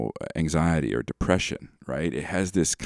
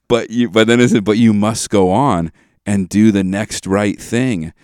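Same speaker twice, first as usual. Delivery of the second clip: slow and heavy, leaning into each gloomy line; mock-tragic Disney ballad reading; dramatic lyric recitation; 3 semitones higher, 7 dB louder than usual. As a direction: recite the song like a grave confession. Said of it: "the line is".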